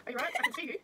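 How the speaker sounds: normal talking voice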